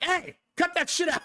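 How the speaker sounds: high, laughing mock scold; mock stern dad. Dad mock-scolds his toddler, laughing. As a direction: scold through a laugh, not meaning it. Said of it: "I'm like".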